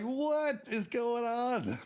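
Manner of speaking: very high, slow, stretched-out disbelief; hammed-up shocked wail; bewildered outcry. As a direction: draw it out in mock alarm. Retold shock at finding panther.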